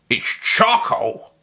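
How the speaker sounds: slow surprised exclaim; drawn-out surprise at what he sees. A narrator's reading. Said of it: "the doctor exclaimed".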